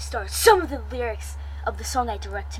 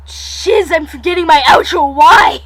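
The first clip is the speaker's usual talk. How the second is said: exasperated shout; 3 semitones higher, 11 dB louder than usual. Real, not acted. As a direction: yell at yourself, building to a wail. Forgets outro, yells at self.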